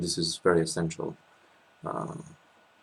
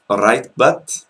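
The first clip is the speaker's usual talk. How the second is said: loud, higher-pitched Welsh accent demo; 6 semitones higher, 13 dB louder, slower than usual. Shown as a demonstration. Showing the co-host how Welsh people sound, loud Welsh accent. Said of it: "the Welsh accent, how, how would you say".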